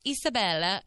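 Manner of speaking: name said with an Italian lilt; lightly put-on Italian pronunciation; Italian accent. Shown as a demonstration. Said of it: "say it with the Italian accent".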